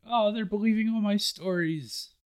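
high, pleased little brag; gleeful boast. Retold or imitated. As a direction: gloat like a liar who got away with it. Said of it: "Usopp was saying".

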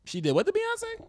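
high, startled disbelief; shocked question. Real, not acted. Friend reacts to the [name] rumor with shocked disbelief.

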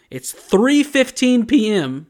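high, loud, slow exasperated correction; exasperated insistence. Real, not acted. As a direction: insist on the time, fed up.

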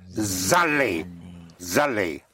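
ZALLEZ starting on a clear Z; slow-motion liaison. Shown as a demonstration. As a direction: slowly say zallez, the Z pushed onto allez. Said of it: "Again, in slow motion".

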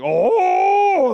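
loud high angry oh; dad seeing the chair spin, loud; furious outburst. Retold or imitated. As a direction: blow up in exasperation. Exploding at the toddler.

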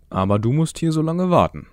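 put-on man calling to the boy while leaving; calling back while walking off. A narrator's reading. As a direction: shout it back over your shoulder. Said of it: "rief der Mann schon im Weggehen".

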